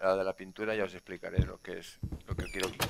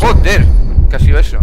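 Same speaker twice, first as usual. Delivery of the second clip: startled shout; 9 semitones higher, 27 dB louder than usual. Real, not acted. Startled by sudden noise.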